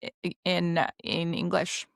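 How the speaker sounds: usual speaking voice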